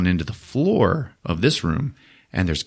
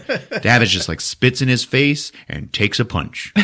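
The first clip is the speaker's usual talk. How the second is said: ordinary delivery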